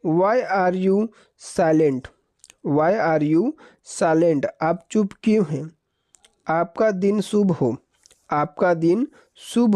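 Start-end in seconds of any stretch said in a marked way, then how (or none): 0.1-4.5 very slow, word by word; slow dictation. Shown as a demonstration. Teacher dictating the English question to students, very slow. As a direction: stretch the question out for the class. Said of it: "English".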